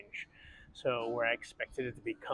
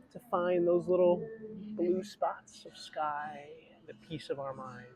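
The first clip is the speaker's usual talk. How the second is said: guided breathing; 3 semitones higher than usual. Acted. Leads a breathing exercise.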